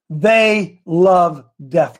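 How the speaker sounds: each word drawn out very slowly; slow emphatic. Real, not acted. Host preaching to his listeners, slow and heavy. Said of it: "Bo, I'm hot today".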